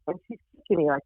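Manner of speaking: usual speaking voice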